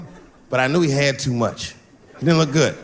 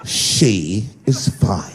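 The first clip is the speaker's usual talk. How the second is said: very slow, lower, icy correction; cold correction; 7 semitones lower, much slower than usual. Retold or imitated. Partygoers coldly correcting the pronoun, slow and icy. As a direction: drop it low and slow, pointedly.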